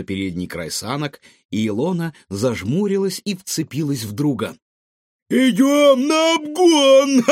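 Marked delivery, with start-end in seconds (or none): 5.3-7.2 high, loud laughing yell. A narrator's reading. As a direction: scream it with wild glee. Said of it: "вопила Светка".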